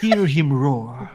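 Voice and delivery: slow, low mock-documentary narration; David Attenborough. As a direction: draw out each word like a wildlife narrator. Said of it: "it's Attenberg".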